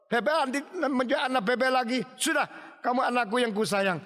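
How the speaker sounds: high, animated fatherly dismissal; reassuring father. Acted. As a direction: brush off the fear with hearty reassurance. Father brushes off child's confession.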